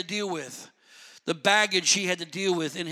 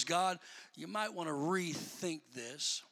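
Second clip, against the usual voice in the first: much softer, slow hesitant plea; hushed aside; 3 semitones lower, 12 dB quieter than usual. Retold or imitated. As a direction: murmur it as if backing away. Paraphrased Saul, hushed.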